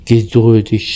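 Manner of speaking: ordinary delivery, nothing marked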